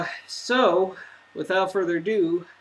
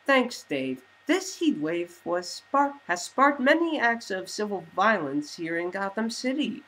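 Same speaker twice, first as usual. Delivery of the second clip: higher, louder bright newscast; news anchor read; 5 semitones higher, 3 dB louder than usual. Acted. TV anchors reporting on Bruce's crash, newscaster style. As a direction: deliver it like an evening news anchor.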